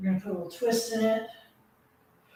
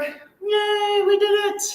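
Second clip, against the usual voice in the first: high, bright cheer of relief, much higher; excited cheer; 9 semitones higher, much slower than usual. Real, not acted. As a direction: celebrate like the finish line. Cheering class after workout.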